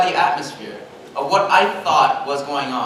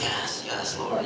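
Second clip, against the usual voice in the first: whispered agreement with God; soft, breath-only praying; hushed prayer; 8 semitones lower, 10 dB quieter, much slower than usual. Retold or imitated. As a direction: imitate a sympathetic prayer murmur. Acts sympathetic murmured prayer.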